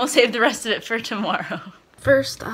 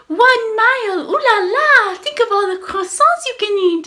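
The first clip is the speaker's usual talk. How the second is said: high, loud mock French accent, sing-song; mock French lady; 14 semitones higher, 7 dB louder than usual. App voice cheering on a runner in a mock French accent. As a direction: ham up a French lady's accent. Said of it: "I had Mademoiselle, the French lady".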